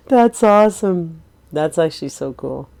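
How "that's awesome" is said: slow, loud and thrilled; excited. Real, not acted. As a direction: gush over the cute puppy. Delighted by new ghost puppy.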